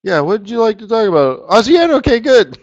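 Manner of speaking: high, loud put-on back-and-forth; mock audience exchange. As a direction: pitch it way up, playing at chatting with the audience.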